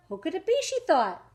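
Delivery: usual speaking voice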